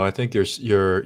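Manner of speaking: normal talking voice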